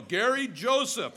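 a parent calling the full name in trouble; full-name scolding. Retold or imitated. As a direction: middle name called loud and high in warning. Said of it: "whenever they use your middle name".

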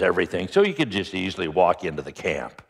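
ordinary talk, nothing marked

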